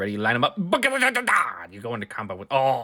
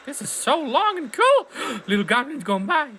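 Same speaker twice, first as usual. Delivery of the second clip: thrilled; 14 semitones higher, 5 dB louder than usual. Real, not acted. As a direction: gush with wonder. Thrilled on minecart ride.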